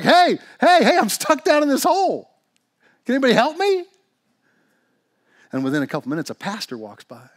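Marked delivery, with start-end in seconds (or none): 0.0-3.9 high, loud calls from a trapped man; yelling for help. Acted. Man stuck in a hole calls to passers-by, yelling. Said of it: "He starts yelling".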